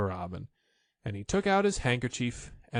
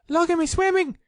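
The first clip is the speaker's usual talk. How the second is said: very high, loud squeaky little voice; Roo squeaking; 7 dB louder than usual. A narrator's reading. Roo showing off his swimming, high squeak. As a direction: squeak it like a tiny proud joey.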